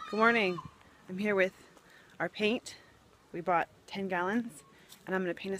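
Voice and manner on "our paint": usual speaking voice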